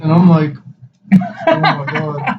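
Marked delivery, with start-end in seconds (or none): 1.5-2.4 high, breathy-shocked oh my God; shocked gasp. Retold or imitated. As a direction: gasp it out in disbelief. Shocked at pregnant friend's news.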